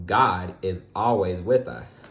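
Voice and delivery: usual speaking voice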